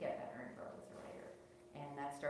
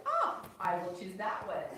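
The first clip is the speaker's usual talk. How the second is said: louder, slow, faux-naive pick; mock innocent choice; 5 semitones higher, 12 dB louder, slower than usual. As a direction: play it like a sly, innocent pick. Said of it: "and then I'm like".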